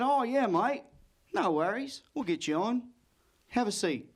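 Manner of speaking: easygoing Aussie host, lilting upward; Australian host. Acted. Host reassuring a visiting comic, in an Australian accent. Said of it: "I tell the host".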